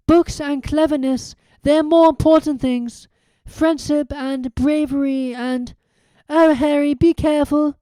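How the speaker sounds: high, pleading schoolgirl; tender, tearful young girl; emotional girl. Acted. Hermione emotional farewell.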